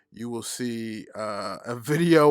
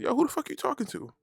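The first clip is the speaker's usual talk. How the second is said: much higher mock-offended voice; indignant comeback; 13 semitones higher than usual. Host retelling his comeback to her, high-pitched indignation.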